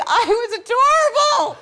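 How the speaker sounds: high, pleased little voice; mock self-admiring voice. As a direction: squeal it like you're proud of yourself. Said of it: "you're just being like".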